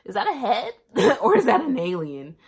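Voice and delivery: loud high laughing alarm; freaking out. Retold or imitated. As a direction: shriek it, half laughing. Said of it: "I was freaking out".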